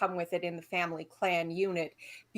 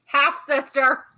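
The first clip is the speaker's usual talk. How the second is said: loud, high, drawn-out interjection; emphatic slow shout of correction; called-out correction; 7 semitones higher, 10 dB louder, slower than usual. Real, not acted. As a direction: call it out slowly to correct someone. Calls out a correction.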